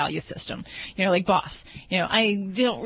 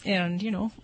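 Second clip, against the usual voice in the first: ordinary delivery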